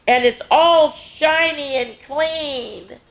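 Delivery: high, slow, amazed delight. A narrator's reading. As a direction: savor the wonder of it. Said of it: "he cried".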